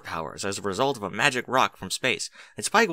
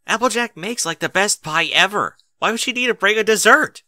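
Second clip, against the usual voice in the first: indignant; 11 semitones higher, 8 dB louder than usual. Acted. Spike indignant about the dessert.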